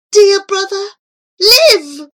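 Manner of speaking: high, loud pleading cry. Acted. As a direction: beg him to come alive. Girl pleads over heart.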